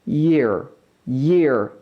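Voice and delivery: YEAR with a small ye sound slipped in; light y-glide. Shown as a demonstration. Teacher modelling the correct word for learners, YEAR with a light y-glide. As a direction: say YEAR starting on a gentle y, slowly. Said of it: "중간에 예 소리를 살짝만 넣어보세요".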